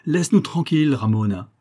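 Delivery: loud shout. A narrator's reading. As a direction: shout her away forcefully. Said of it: "il cria d'une voix forte". (ordre crié d'une voix forte)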